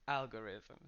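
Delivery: algorithm with stress on the first syllable AL; algorithm said carefully. Shown as a demonstration. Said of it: "the stress is in the first syllable".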